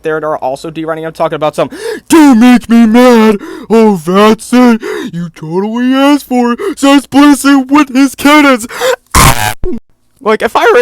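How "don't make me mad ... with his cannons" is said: high, loud, slow mocking tantrum voice; mock angry rant. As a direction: ham up a furious whiny defender.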